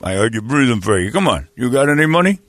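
mumbling Stallone voice; lightly voiced Sly on the phone; Sylvester Stallone impression. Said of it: "it's a Sly".

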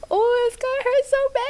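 hammed-up whimper of dread; mock dread. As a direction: play up the dread like a scared kid. Dreading painful first poop.

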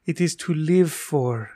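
slow, lightly voiced mother, savoring each word; a hint of mom's slow, fond way of saying it; imitating mom. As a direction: son quoting his mother's favorite phrase, gently mimicking her slow delivery. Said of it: "as my mother says".

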